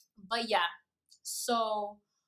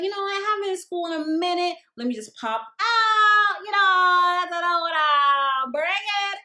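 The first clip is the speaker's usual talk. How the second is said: excited self-quote; 6 semitones higher, 8 dB louder than usual. Retold or imitated. Excited self-quote about going out.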